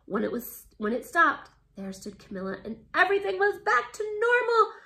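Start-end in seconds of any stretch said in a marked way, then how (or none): 2.9-4.7 high, loud joyful shout. Acted. Camilla cured, a high joyful shout. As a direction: burst out with relief. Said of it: "she shouted".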